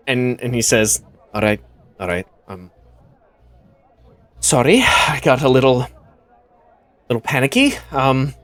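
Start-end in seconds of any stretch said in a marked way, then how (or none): 1.3-8.3 a hint of panic under the apology; worried and quiet. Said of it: "the worry is still there".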